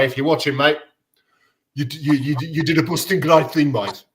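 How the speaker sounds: a lightly put-on Brummy voice thanking the steward; Brummy accent. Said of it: "That was my Brummy accent impression".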